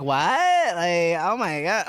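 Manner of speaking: mock valley girl, hammed up. Joking about talking like a valley girl, mock valley girl voice. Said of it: "talking like a valley girl".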